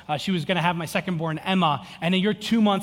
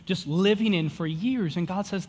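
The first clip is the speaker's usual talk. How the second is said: ordinary delivery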